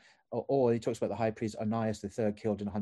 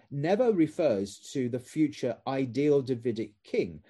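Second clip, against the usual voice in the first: ordinary talk, nothing marked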